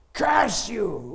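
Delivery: a loud, high, furious curse; screaming curse. Retold or imitated. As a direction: hurl the curse at him. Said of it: "Oh, screaming".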